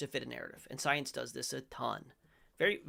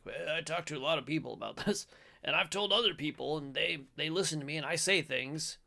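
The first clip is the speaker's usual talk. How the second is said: put-on voice, lively and slightly raised; mock pundit; 3 semitones higher, 4 dB louder than usual. Mocking a journalist's take for viewers, put-on pundit voice. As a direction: send up a self-important commentator. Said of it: "whoever this guy is, some dude, he's like".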